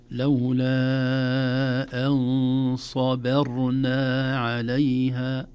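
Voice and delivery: very slow, loud tajweed chant; Quran recitation. Shown as a demonstration. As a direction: recite with long held vowels and careful letters. Recites the verse.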